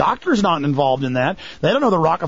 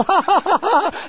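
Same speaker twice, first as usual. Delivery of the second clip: high, mocking fake laugh "ha, ha, ha"; mock laughter; 12 semitones higher than usual. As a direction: cackle like the cronies who are in on it. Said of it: "the people with him go".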